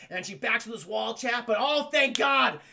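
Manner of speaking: ordinary delivery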